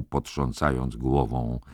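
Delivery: ordinary delivery, nothing marked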